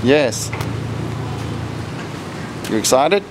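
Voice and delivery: ordinary talk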